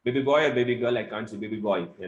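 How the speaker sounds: usual speaking voice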